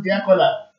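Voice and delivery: normal talking voice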